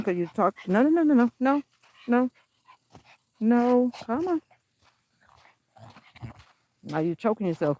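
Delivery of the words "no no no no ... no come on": rapid loud high no-no-no; shouting at Mocha pulling on the leash; urgent scolding. Real, not acted. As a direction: stop the dog right now.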